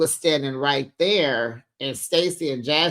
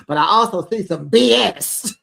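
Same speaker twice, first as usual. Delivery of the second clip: raised voice; 4 semitones higher, 6 dB louder than usual. Retold or imitated. Jassy confronting Stacy in recap.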